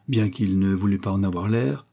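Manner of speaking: ordinary delivery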